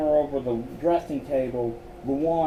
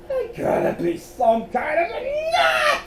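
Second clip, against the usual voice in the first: very high-pitched hysterical squeal; panicked screech; 4 dB louder than usual. A narrator's reading. Scared Miles shifts gears, hysterical high screech. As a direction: squeal it like a frantic coward.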